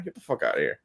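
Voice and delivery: a deep, quiet, fed-up brush-off; low muttered dismissal. Real, not acted. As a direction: drop low and soft, almost under the breath. Ranting against crowdfunding.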